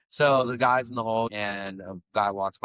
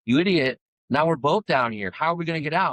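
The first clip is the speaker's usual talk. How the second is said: higher, louder retold exasperation; exasperated man; 8 semitones higher, 4 dB louder than usual. Man in the hole to his would-be helper, mock exasperation. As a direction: imitate the trapped guy scolding his rescuer. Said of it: "and he goes".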